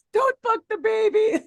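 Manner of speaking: mock high quote. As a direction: pitch it way up, mocking.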